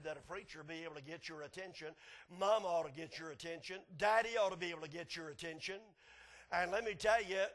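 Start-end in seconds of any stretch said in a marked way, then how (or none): none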